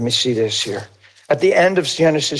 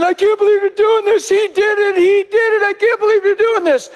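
high-pitched imitated protest scream; hammed-up frantic screaming, far above normal pitch; screaming brother; faster than usual. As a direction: wail the injustice like a wronged kid. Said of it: "he's screaming".

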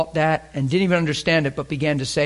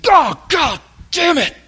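hammed-up yell, much higher and louder, slow; mock swearing outburst; 13 semitones higher, 7 dB louder, slower than usual. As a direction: curse out loud like you hit your thumb. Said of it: "You're hammering".

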